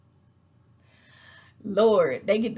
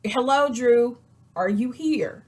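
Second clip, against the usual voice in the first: mock calling out; 4 semitones higher, 4 dB louder, slower than usual. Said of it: "'Cause it's like".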